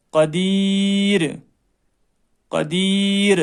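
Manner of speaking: قدیر said slowly with a light, thin ر. Shown as a demonstration. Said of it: "باریک کی مثال را".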